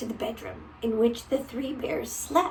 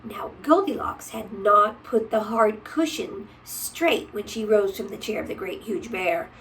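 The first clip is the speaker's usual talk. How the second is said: ordinary delivery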